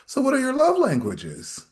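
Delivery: high-pitched imitation of a new date; mock dating small talk. Voices new dates' small talk.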